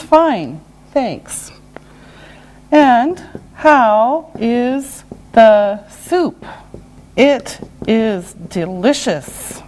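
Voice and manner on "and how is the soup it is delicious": word by word with gaps; slow and deliberate. Shown as a demonstration. Teacher modelling a soup example, slow and separated. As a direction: space out every word for learners.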